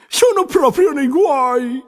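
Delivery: high, slow despairing cry; a young beaver sure he's in trouble. A narrator's reading. Arturo despairs after lament. (castoro disperato, acuto e lento)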